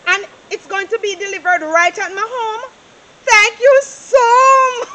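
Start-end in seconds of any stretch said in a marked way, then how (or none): 3.2-4.9 slow, loud, crying thanks; tearful shout of thanks. Acted. Mother thanking her son on the phone, loud and tearful. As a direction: cry out your thanks, overcome. Overjoyed at gift of barrels.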